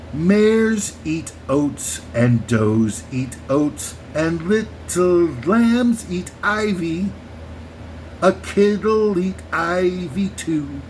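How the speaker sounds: slowed right down. Shown as a demonstration. Showing listeners the real lyric, very slow. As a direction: spell it out slowly so every word lands. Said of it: "Let me slow it down".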